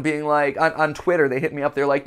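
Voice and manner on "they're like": normal talking voice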